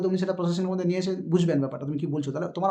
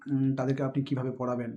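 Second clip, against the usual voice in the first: lower, grave interviewer voice; serious question; 5 semitones lower, 3 dB quieter than usual. Retold or imitated. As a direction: ask it gravely, like a concerned interviewer. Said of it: "খুব serious মুডের question".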